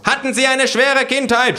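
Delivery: very high, loud questioning voice; shrill voice from a survey mob; badgering pollster. A narrator's reading.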